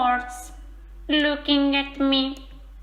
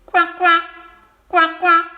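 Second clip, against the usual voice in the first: louder, playful quacking; loud duck quacks; 6 dB louder, faster than usual. Pretend. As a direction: shout the duck sound to the class. Child shouts duck noises.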